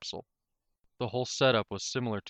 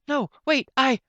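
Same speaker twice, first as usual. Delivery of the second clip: much higher and louder cry to cut in; flustered interruption; 10 dB louder than usual. Acted. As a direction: blurt it out to stop him. Soft Heart tries to reply.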